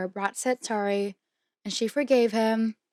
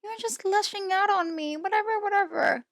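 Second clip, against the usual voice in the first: high-pitched mocking quote; mock psychology talk; 10 semitones higher than usual. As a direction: mimic her in a much higher voice. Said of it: "her little, like, psychology trick".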